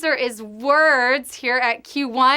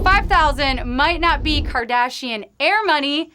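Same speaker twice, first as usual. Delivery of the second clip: usual speaking voice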